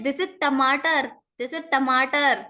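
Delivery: tomato as Hindi "tamaatar", hard curled T, emphatic; Hindi T sound. Shown as a demonstration. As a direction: insist on tomato with firm retroflex Ts. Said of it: "I'll say".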